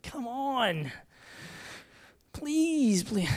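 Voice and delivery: high, drawn-out begging; mock pleading. Begging wife to do the telling.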